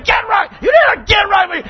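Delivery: high-pitched re-enacted pulpit yell; preaching shout. Preacher re-enacting his roach-killing sermon, mock preaching shout. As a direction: holler it like an urgent preacher. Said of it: "I'd be preaching".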